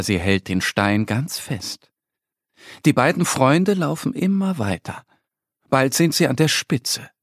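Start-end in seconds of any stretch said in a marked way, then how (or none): none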